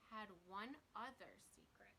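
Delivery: breathy storyteller's whisper; hushed, mysterious reveal. A narrator's reading. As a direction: whisper the secret to the listener. Narrator reveals a hidden secret.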